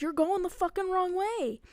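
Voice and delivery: high, loud urgent warning; acted-out exasperated alarm; retold alarm. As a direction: cry out a warning to the driver. Retold warning to grandma driving wrongly.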